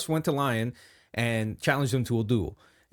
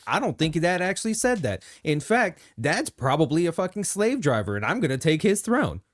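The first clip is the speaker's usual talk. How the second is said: defiant Angron voice; 6 semitones higher, 3 dB louder than usual. Retold or imitated. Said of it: "He's like".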